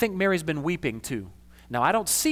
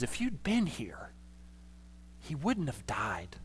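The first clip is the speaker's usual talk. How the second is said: much softer and slow, voice changed; grief-stricken; 11 dB quieter, slower than usual. Retold or imitated. Mary fallen at Jesus' feet in grief, soft and broken. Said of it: "broken by her grief".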